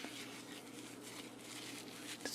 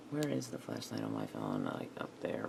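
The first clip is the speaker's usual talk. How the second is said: muttered low and much softer, half to herself; low mumble to herself; 11 dB quieter than usual. Real, not acted. Searching her phone, mumbling the question low and soft. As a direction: murmur the question under your breath, much lower and softer. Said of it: "I gotta remember".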